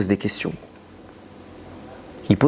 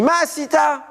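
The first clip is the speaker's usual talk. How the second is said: God rebuking. Acted. God's Hebrew rebuke to Cain.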